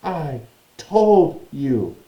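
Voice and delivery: slow, high gasped reproach; gasping. Acted. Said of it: "Hermione gasped".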